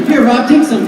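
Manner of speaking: high, loud, eager offer; hammed-up giddy sharing; mock excited. Offering a pal imaginary wings, high excited voice.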